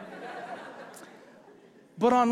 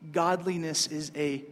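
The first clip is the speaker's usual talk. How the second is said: ordinary delivery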